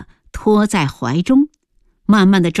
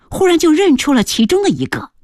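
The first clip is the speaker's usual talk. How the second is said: ordinary delivery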